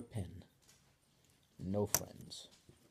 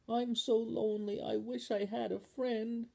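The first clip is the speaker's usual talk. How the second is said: higher, wistful little voice; lonely voice from the can; 11 semitones higher than usual. Acted. Voice in Spoon's can, higher and wistful. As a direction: sound small and alone.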